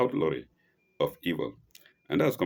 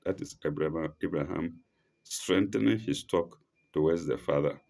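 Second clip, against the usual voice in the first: ordinary talk, nothing marked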